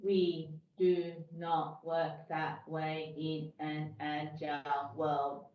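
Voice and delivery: slow, low, monotone robot. Pretend. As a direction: drone each word like a machine. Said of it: "speak like a robot now".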